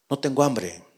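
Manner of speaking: más suave y bajo, sin ganas; desganado. Acted. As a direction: contesta apagado desde dentro. Respuesta desde la cueva. (respuesta apagada y suave)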